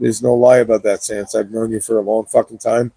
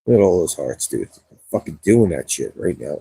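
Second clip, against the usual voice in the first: ordinary delivery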